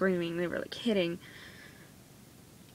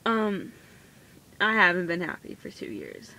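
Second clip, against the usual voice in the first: normal talking voice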